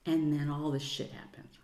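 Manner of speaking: normal talking voice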